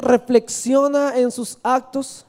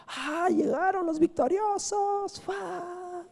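put-on high, slow, soft girlish welcome; joyful daughter; 10 semitones higher, 11 dB quieter, much slower than usual. Daughter running out to greet her victorious father, high and soft. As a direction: greet the returning troops as a delighted girl. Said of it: "salía alegre con panderos y danzas".

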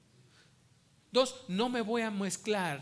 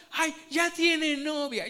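slow, higher, louder mocking parent voice; mock proud parent; 9 semitones higher, 8 dB louder, slower than usual. Preacher mocks parents boasting their kindergartner has a girlfriend, slow and high. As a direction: mimic a parent bragging fondly. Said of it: "papás están permitiendo".

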